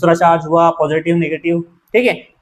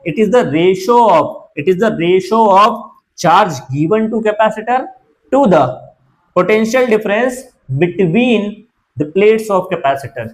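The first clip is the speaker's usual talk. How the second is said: slow, deliberate definition with pauses; measured classroom dictation; dictating; much slower than usual. Real, not acted. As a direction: dictate it slowly, phrase by phrase.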